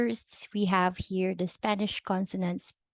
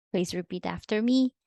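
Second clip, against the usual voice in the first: ordinary talk, nothing marked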